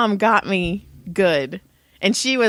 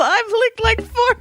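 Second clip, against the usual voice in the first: quoting grandpa laughing; 13 semitones higher than usual. Retold or imitated. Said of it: "And he's all".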